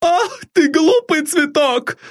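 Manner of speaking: very high, doting servant cooing fondly; an old servant fussing over her mistress; high, fond, bustling maid voice; fond exclaim from old maid. A narrator's reading. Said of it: "ласково воскликнула она".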